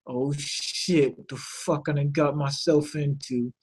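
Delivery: a private panicked thought, said low and soft; inner thought. Retold or imitated. Bolin in his head, realizing his mistake, soft inner voice. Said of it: "But you see in his head".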